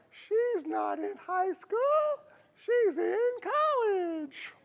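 very high, slow, drawn-out mock Southern drawl; hammed-up Southern accent. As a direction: stretch it out in a badly faked high Southern voice. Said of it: "I can't do a Southern voice".

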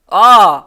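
sudden loud high gasp of surprise; a sharp startled cry; surprised shout. Real, not acted. Streamer caught off guard by a play, loud startled cry.

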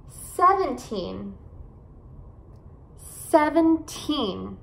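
put-on SEVENTEEN slowly, with a long TEEN; seven-TEEN, careful drawn-out model; stress on final syllable. Shown as a demonstration. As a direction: lean on the end of SEVENTEEN. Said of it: "esses números com teen".

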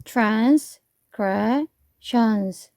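transgressions said very slowly, each syllable broken apart, the second one stressed. Shown as a demonstration. Teacher shows students how to drill a long word, syllables split slowly. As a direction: chop transgressions into separate syllables at a crawl. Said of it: "음절을 끊어서".

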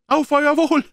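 high, loud, frantic toast; called out. Acted. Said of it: "rief er". (lauter, hoher Trinkspruch)

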